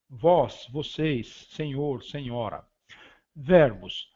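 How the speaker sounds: ordinary talk, nothing marked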